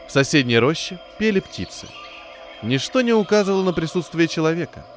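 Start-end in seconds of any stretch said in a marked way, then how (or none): none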